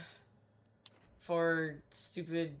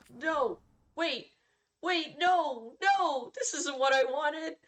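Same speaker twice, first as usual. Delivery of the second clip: rising panic, climbing much higher and louder; panicked denial; 10 semitones higher, 5 dB louder, slower than usual. Retold or imitated. Gamer realising the mission has no dragon, panicked. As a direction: backpedal in mounting alarm. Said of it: "And then I was like".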